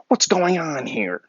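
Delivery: high, slow imitation of a girl; girl who was watching. Girl who ran over to the fight, imitated high. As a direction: mimic her stepping in, drawn out and high. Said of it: "some girl that was watching ... she's like".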